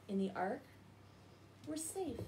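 usual speaking voice

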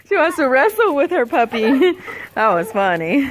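loud high laughter; laughing hard at the dog. Real, not acted. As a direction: burst out laughing at the puppy. Laughing at puppy wrestling.

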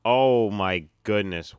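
loud, drawn-out astonishment; real shock, stretch the words out loudly; stunned amazement. Real, not acted. Viewer reacting to a shocking scene, stunned.

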